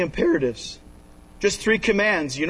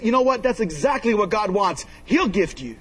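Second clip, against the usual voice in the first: normal talking voice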